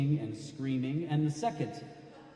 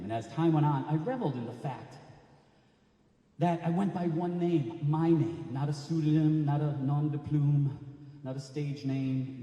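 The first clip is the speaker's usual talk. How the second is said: usual speaking voice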